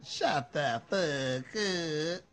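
very slow, drawn-out words of amazement; stunned disbelief. Real, not acted. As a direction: stretch every word in disbelief. Disbelief at the gossip.

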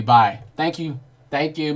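usual speaking voice

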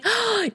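quick, high gasp; sharp gasp of delighted surprise; excited gasp. Real, not acted. Player seeing Marlon's success, excited gasp. Player sees level reached.

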